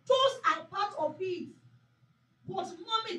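measured, deliberate sermon delivery; slow, emphatic preaching to mothers. Acted. Pastor exhorting mothers before prayer, deliberate preaching.

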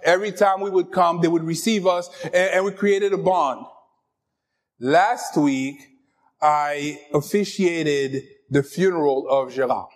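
usual speaking voice

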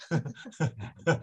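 short chuckle; brief real laugh mid-talk. Real, not acted. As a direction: laugh at your own joke. Joking aside about giving things away.

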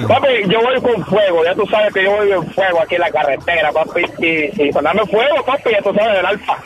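reggaeton persona. Acted. Caller acts a reggaeton driver.

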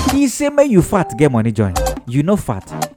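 ordinary talk

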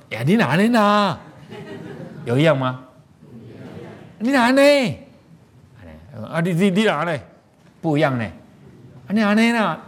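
sharp, high, loud rude comeback; rude snapping retort. Shown as a demonstration. Teacher acting out a rude reply to show bad expression. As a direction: bite back at someone irritably.

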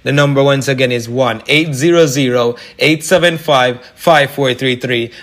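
usual speaking voice

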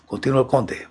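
ordinary delivery, nothing marked

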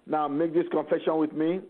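ordinary talk, nothing marked

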